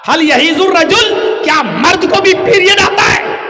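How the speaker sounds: loud retort. Retold or imitated. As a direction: throw the question back hard. Man retorts, outraged.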